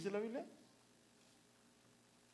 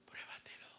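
whispered, coaxing devil; devil's little voice, whispered; 15 dB quieter than usual. Retold or imitated. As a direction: coax her in a whisper. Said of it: "la vocecita me decía".

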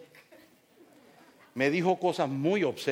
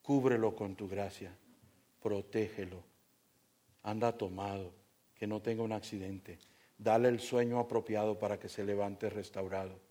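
soft, lower prayerful voice; 6 semitones lower, 6 dB quieter than usual. Retold or imitated. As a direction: pray quietly for the drunk. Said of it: "yo estaba orándole al Señor". (oración suave y baja)

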